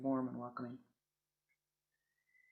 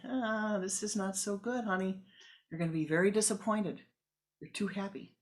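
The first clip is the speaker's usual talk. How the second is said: a lightly voiced, higher, fussing mama; a hint of a fretful mother cautioning; mama warning her smiling daughter; worried mother; 6 semitones higher, 4 dB louder than usual.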